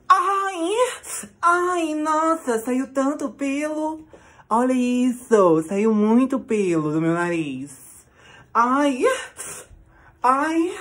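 high, loud shrieking in pain and shock; pain shrieks. Real, not acted. Vlogger after pulling nose wax, loud pained shriek. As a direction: yelp as the wax rips out. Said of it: "tá doendo demais".

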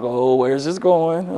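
slow, low, hushed inner thought; wary aside. Retold or imitated. Professor wary of a student's loaded question, muttered aside. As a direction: mutter it slowly and softly, suspicious.

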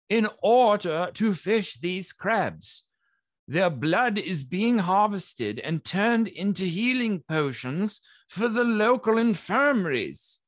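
lightly voiced official justifying the work; earnest licensed crab harvester. Crab harvester defends the licence to objectors, earnestly. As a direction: explain yourself with measured, emphatic patience.

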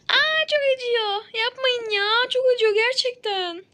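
high, loud wailing in pain; loud yelps of dental pain; pained crying out. Acted. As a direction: cry out as the tooth hurts. Patient in pain at dentist.

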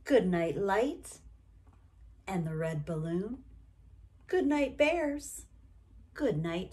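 ordinary delivery, nothing marked

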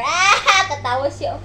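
usual speaking voice

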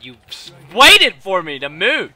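frustrated. Real, not acted. Angry at being camped.

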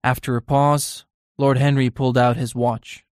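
ordinary delivery, nothing marked

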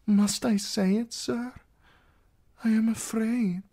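high, fragile princess voice; vulnerable. A narrator's reading. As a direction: plead for protection in a lifted voice. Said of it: "He had never heard her sound so vulnerable".

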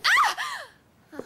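sudden very high shriek; startled scream. Acted. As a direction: jump out of your skin with a yelp. Startled by the creepy dog.

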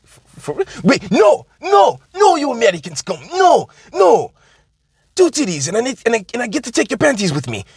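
hammed-up foreign captive, high and excited; giddy captive. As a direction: squeal and bargain like a man who can't believe his luck. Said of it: "He goes".

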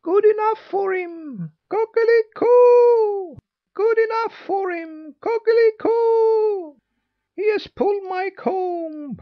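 piercingly high, loud rooster voice; crowing rooster. A narrator's reading. Rooster gloating at the bewitched boy, loud high crowing. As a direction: crow the words out on a long high note. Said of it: "crowed the rooster".

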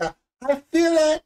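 hammed-up Cajun Rose, breathless with wonder; mock Cajun Rose on the bow of the ship; Cajun Rose impression. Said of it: "And she has her arms like".

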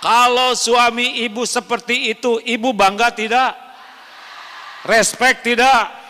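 high, loud rhetorical questions; rousing preaching. Real, not acted. Preacher stirring the wives with loud, high rhetorical questions. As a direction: whip the crowd up.

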